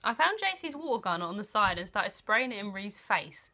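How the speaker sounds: normal talking voice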